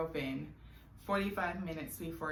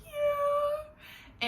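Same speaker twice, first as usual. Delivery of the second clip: high, squeaky choked-up yeah; tearful yes; 5 dB louder than usual. Retold or imitated. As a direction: squeeze it out through happy tears. Answering while crying with joy.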